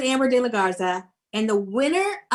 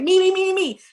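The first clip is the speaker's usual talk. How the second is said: eager begging; 7 semitones higher, 4 dB louder than usual. Retold or imitated. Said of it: "Sarah's like".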